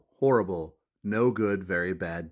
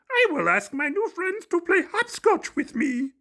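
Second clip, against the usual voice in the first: high furry-monster puppet voice; eager little monster asking to play; high, bright puppet monster voice; hopeful Grover; 5 dB louder than usual. Acted. Grover voiced in picture book.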